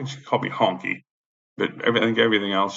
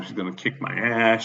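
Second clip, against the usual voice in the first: ordinary delivery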